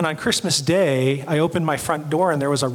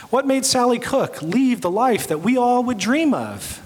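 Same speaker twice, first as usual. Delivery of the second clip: usual speaking voice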